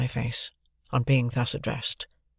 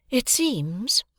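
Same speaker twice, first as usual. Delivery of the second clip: put-on a cool, calm, higher young woman's voice; calm Estella; 10 semitones higher than usual. A narrator's reading. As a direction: Estella speaking very calmly. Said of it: "said Estella very calmly".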